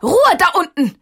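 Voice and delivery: resolute shout. A narrator's reading. Tante Elli shouts down at the quarrelling stones, resolute. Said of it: "rief auf einmal eine resolute Stimme".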